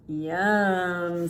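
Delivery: a lightly put-on satisfied squirrel; mild squirrel voice. Squirrels pleased with their stolen food, light critter voice. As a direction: say it like a full little critter. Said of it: "said the squirrels".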